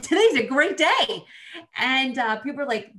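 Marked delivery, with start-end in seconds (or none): 0.0-1.2 upbeat. Retold or imitated. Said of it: "you're always so positive".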